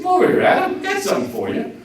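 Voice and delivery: high-pitched woman calling her man over; coaxing Eve. Acted. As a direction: play a wife luring him over, voice way up. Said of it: "and she said".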